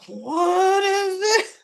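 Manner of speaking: high, drawn-out exaggerated disbelief; mock shock. Bill seeing Andre and Danny hugging, comically aghast. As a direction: stretch it way up high, hamming up the shock. Voicing look on Bill's face.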